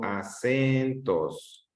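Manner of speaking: "acentos" slowly, with "cen" held long; stressed syllable drawn out. Shown as a demonstration. Teacher asking which syllable is strongest, "acentos" stretched on "cen". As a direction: stretch a-CEEEN-tos, falling on "tos". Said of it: "Dónde está la sílaba de mayor intensidad".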